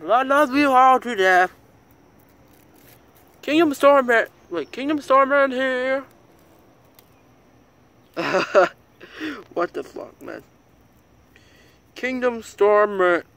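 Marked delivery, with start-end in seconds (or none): 0.1-6.0 loud, high, excited shout-out. Real, not acted. Loud excited channel sign-off. 11.9-13.2 announcer voice. Acted. Announces channel name dramatically.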